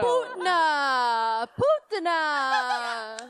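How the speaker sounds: very slow, loud mock Russian accent. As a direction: drag the name out in a Russian accent. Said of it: "No one ever gives a shit for the Russian accents".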